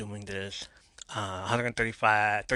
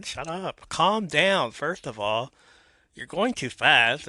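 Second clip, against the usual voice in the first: half-joking scold, higher and louder; mock scolding; 4 semitones higher, 6 dB louder, slower than usual. Host scolding his younger self on the recording, mock scolding. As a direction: tell your old self off, teasingly.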